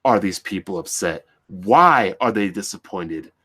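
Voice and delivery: quoting the clueless company, pitched low; mock clueless exec. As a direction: play the confused executive, lightly. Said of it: "Instead of asking".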